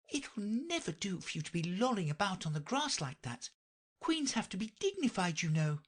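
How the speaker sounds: a touch of stern scolding in a high voice; mock severe. A narrator's reading. Alice scolding herself, lightly stern in a high voice. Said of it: "she went on in a severe tone".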